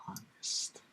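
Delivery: barely audible whisper; whispered aside. Real, not acted. Commentator qualifying his own claim of honesty, whispered aside. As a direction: whisper it as an afterthought.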